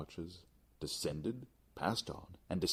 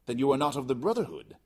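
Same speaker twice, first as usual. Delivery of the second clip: higher, louder probing voice; challenging question; 9 semitones higher, 10 dB louder, faster than usual. Acted. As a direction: test him with the question. Fortunato questions narrator.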